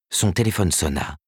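plain reading voice

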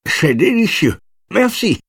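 put-on high, happy kid exclaiming; delighted child. A narrator's reading. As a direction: gush with a full mouth. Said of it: "s'exclama la bouche pleine". (enfant ravi, bouche pleine)

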